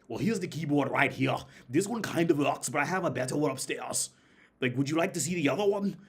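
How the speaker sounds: high, loud imitation of an Austrian seller; Austrian accent. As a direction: put on an Arnold-style Austrian accent. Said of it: "had his exact accent".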